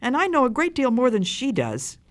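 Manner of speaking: high-pitched boastful protest; child's protesting cry. A narrator's reading. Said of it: "cried the first child".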